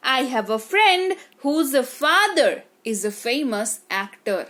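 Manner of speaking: very slow, clear teaching read; slow model sentence. Shown as a demonstration. Teacher modelling sentence slowly for students. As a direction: say it word by word, unhurried. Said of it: "teacher models English sentence".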